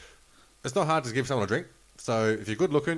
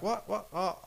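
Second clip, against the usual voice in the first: flustered denial; 11 semitones higher than usual. Retold or imitated. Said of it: "She's like".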